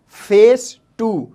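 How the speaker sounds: फेस टू said slowly, word by word; slowly repeated. Shown as a demonstration. Teacher repeats the English slowly for the class. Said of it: "English में हम कहते हैं".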